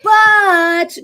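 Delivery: the brain cutting in with a loud but; brain objecting. Retold or imitated. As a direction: blurt out the objection. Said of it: "your brain's gonna go".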